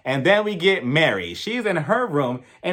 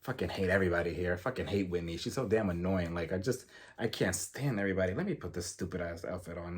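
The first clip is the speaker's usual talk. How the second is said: low, much softer grumbling under the breath; muttering to herself; 11 semitones lower, 12 dB quieter than usual. Retold or imitated. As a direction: mutter it darkly to yourself, way down low. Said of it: "she's just talking to herself".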